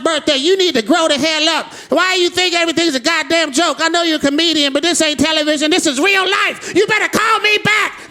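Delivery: put-on high angry old woman on voicemail; shrill-free but high, slowing scolding grandma voice. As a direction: chew him out like an outraged grandmother. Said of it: "She's like".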